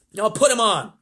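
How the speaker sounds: high, loud presidential order; commanding president. A narrator's reading. President orders astronaut on air.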